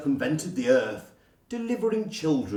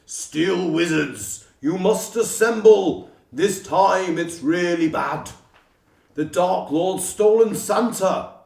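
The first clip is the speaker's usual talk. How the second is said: loud, commanding summons from on high; booming voice; 3 semitones higher, 6 dB louder than usual. Acted. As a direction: boom it out like an urgent call to arms. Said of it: "the booming voice would land them".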